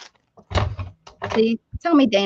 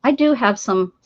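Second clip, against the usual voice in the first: ordinary delivery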